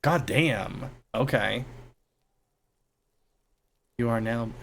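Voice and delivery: ordinary talk, nothing marked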